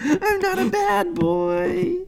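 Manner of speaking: very high, slow, hammed-up whine; mock whimpering plea. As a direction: whimper it slowly like a fake cry. Hammed-up plea about being caught.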